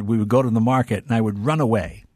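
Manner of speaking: ordinary delivery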